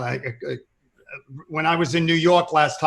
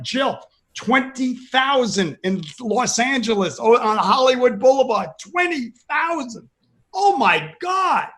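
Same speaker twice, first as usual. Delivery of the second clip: retold amazement; 7 semitones higher than usual. Retelling amazed reaction to TV news.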